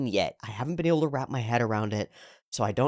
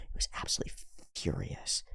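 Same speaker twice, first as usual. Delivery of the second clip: whisper; 12 dB quieter, faster than usual. Real, not acted. Confiding about angry roommate.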